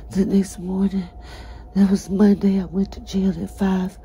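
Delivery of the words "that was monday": usual speaking voice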